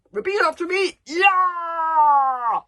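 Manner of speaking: full-throated war cry, high and long; yelling. Acted. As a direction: yell it like a drill leader. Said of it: "yelled Gobber the Belch".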